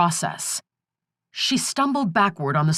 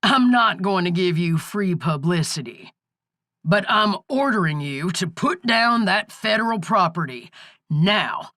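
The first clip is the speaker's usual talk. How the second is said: usual speaking voice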